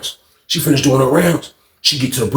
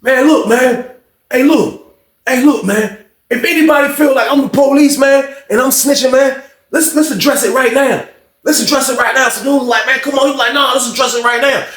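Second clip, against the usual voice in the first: higher, firm mock call-out; retold challenge; 10 semitones higher than usual. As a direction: call everyone out to settle it. Duke challenges room.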